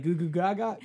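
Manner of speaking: put-on baby voice. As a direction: babble like an infant. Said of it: "the baby's gonna answer and be like".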